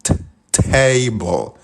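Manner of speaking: table said slowly in English, a clipped t- first and then the full word; English pronunciation. Shown as a demonstration. Teacher showing students how table starts with a consonant. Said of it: "pronunciarlo suena como".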